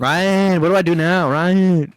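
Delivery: mock helpless Ryan. Ryan helplessly asking the boss what to do, mock whiny. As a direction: ham up a lost worker begging for help.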